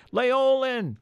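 high, drawn-out searching call. A narrator's reading. Peter searching for the lost elf, calling out. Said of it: "called Peter".

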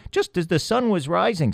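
high, tiny mouse voice; little field mouse chattering; squeaky mouse voice. A narrator's reading. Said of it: "said the other".

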